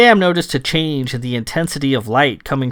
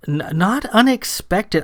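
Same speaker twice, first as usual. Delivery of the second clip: higher, stammered "not unexpected"; taken-aback echo; 7 semitones higher than usual. A narrator's reading. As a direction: stumble on the first sound, question it in a higher voice. Sam echoes him, not understanding.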